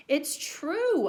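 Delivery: put-on high childlike insistence; insistent boy. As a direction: plead it like a kid.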